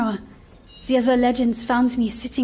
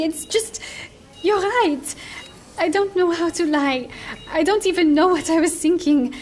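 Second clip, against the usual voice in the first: ordinary delivery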